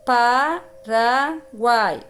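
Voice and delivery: Paraguai slowly as pa... ra... guai; split into syllables. Shown as a demonstration. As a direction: pause after pa and after ra in Paraguai. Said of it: "Guai fica uma sílaba só".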